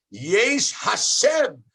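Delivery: each word stretched out slowly; slow declamation. Shown as a demonstration. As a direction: present the verse slowly and emphatically. Teacher slowly declaims the verse.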